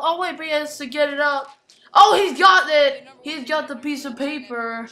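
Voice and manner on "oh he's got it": excited shout. Real, not acted. Winning the paper.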